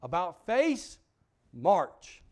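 loud drill orders; commanding. Acted. God turning the sinner around, loud drill commands. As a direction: call it like a drill sergeant. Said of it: "God said".

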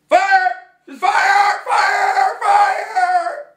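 high, loud screamed alarm; panicked shout. Acted. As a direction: yell it like sounding the alarm in a packed theater. Acting out shouting fire in theater.

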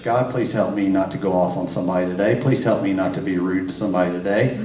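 low, pleading mock prayer; praying. Husband praying on the drive to church, low and pleading. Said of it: "I'm praying all the way to church".

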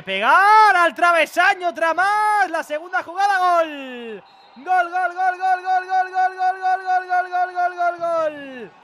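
high, loud goal cry; goal scream. Real, not acted. Goal call.

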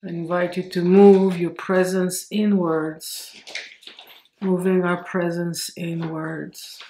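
slow meditation voice, long pauses between phrases; guided meditation. Acted. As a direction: stretch the words out calmly to slow the breath.

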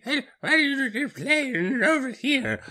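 put-on high, slow old lady. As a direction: ask slowly like a granny. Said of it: "my old friend who's actually just an old lady".

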